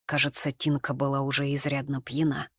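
ordinary delivery, nothing marked

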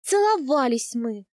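drawn-out, restrained exclamation; crying out. A narrator's reading. Heroine blurts a confession to the khan, mild cry. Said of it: "выкрикнула я".

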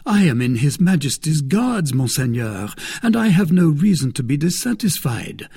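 lightly voiced young guardsman, politely declining; D'Artagnan, deferential. A narrator's reading.

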